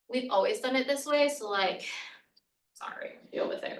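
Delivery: mildly dismissive, dropping soft at the end; defensive brush-off. Acted. Classic reply to a colleague's complaint, dismissively defensive. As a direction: shrug the complaint off, trailing into a quiet retort. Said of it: "this is our defensive response".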